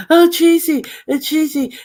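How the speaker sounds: high, whiny-style mock of another woman; mimicking. Woman mimicked greeting her friend as she arrives, mocking.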